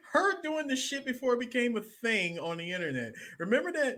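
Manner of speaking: high, amused, animated recall; light up remembering it; excited. Real, not acted.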